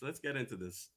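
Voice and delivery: soft, far-off processed voice; like through a voice changer; distorted voice effect. Shown as a demonstration. Voice effect on repeat.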